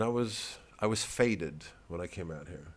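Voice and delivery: usual speaking voice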